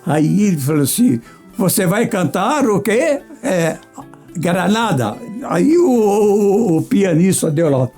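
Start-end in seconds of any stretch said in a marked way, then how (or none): none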